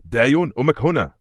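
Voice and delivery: high, loud call; shouting. A narrator's reading. Mother calling to her child, shouting. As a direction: cry out to reach a lost child. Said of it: "تصرخ الأم بكل ما لديها من قوة".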